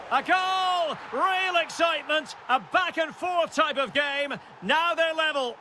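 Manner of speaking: high, thrilled commentator's cry; excited goal call. Acted. Commentator announcing the equaliser to viewers, excited.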